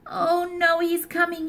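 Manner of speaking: put-on high, hushed child's alarm; a small boy panicking at the bear; scared little boy. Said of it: "said Eddie".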